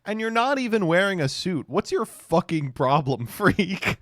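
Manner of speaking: high-pitched hammed-up berating; mock outrage. Officials ganging up on a guest, mock indignant. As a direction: play an indignant bully, voice jumping up.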